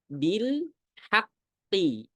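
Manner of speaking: bil haqqi recited again, heavy full qaf; repeated demo. Shown as a demonstration. Teacher repeating the example for students, careful recitation. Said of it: "Jelas nggak?".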